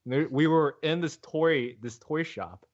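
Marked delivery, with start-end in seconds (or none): none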